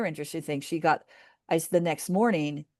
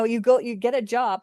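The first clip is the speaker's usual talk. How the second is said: ordinary delivery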